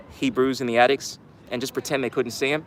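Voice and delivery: usual speaking voice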